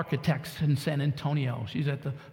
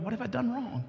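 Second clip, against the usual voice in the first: higher, softer panicked question; panicked young dad; 6 semitones higher, 4 dB quieter than usual. Retold or imitated. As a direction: ask it like a scared new father. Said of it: "And I'm going".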